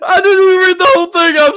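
very high, loud, laughing words; real helpless laughter breaking into speech; laughing protest. Real, not acted. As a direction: protest while cracking up. Cracks up over misread question.

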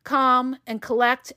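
ordinary talk

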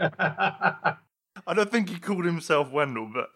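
laughing through the words. Real, not acted. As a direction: giggle while speaking. Laughing through his reply.

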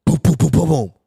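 rapid booming knocks; imitated door knocking. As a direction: voice a fist pounding on a door.

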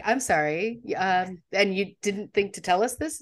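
ordinary talk

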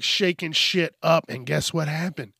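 ordinary talk

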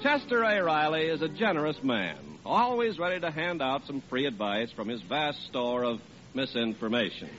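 ordinary delivery

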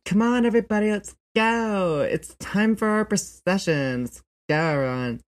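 high, loud, sassy procession leader; rally the parade in a high-pitched camp voice; campy queer voice. Acted. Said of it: "you were doing a queer voice".

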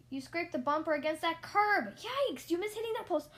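loud, high-pitched yelp of alarm; exasperated, rising panic; alarmed scolding. A narrator's reading. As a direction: cry out at a near crash. Instructor alarmed by learner's mistake.

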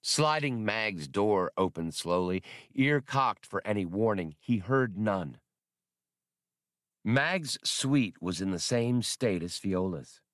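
usual speaking voice

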